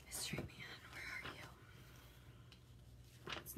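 soft whisper while searching; hushed whisper, talking to oneself; whispering to self. Real, not acted. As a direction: whisper it under your breath.

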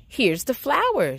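shouted. A narrator's reading. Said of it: "shouted Jack".

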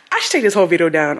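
normal talking voice

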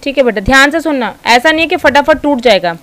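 ordinary talk

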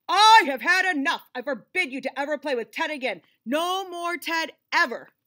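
loud, forceful fatherly shout; furious father. Acted. Father forbids the son from playing with Ted, shouting. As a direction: lay down the law at full volume.

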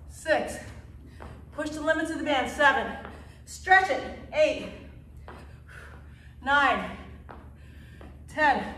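ordinary delivery

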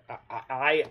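ordinary delivery

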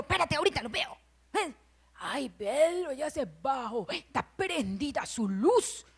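hushed busybody keeping watch; soft, secretive spying voice; nosy snooping voice. Acted. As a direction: murmur while peeking at the neighbor. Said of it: "cambia hasta su voz de uno".

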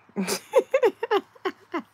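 sudden shrieking laugh, high and loud; loud high cackle. Real, not acted. Laughing at her own story about the husband, a loud, high cackle.

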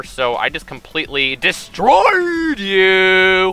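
stretched, slow-motion gloating; slowed-down taunt. Acted. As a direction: drag out the taunt like a slowed replay. Edited slowed taunt replay.

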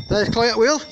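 ordinary talk

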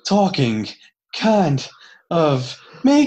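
slow, halting breaths between words; Stevie from Malcolm in the Middle. Acted. Mocking a wrestler's promo skills, slow breath-starved impression. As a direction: drag it out a word or two at a time, loudly. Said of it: "become Stevie from Malcolm in the Middle".

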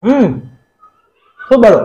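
talking while chewing food; muffled words around food; mouth full, chewing. Real, not acted. Seen 1: Bites chicken leg, then chews with closed mouth while speaking.

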